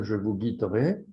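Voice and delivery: usual speaking voice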